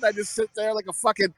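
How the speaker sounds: ordinary delivery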